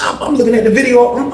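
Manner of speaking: normal talking voice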